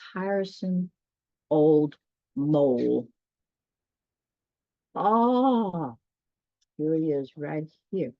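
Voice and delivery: ordinary delivery, nothing marked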